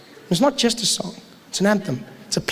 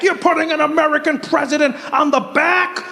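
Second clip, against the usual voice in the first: high, slow, indignant shouting; hammed-up patriotic outrage; higher, slower hammed-up outrage at the back of the bill; mock indignation; 8 semitones higher, slower than usual. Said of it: "People are like".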